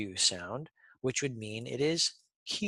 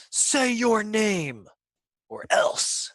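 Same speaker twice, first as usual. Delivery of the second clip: bossy letter; 9 semitones higher, 4 dB louder, slower than usual. Pretend. Bossy E voiced as a bully.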